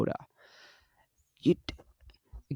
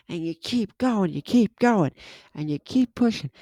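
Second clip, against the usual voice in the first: pushing hard; 7 semitones higher than usual. Real, not acted. Describing pushing through a set.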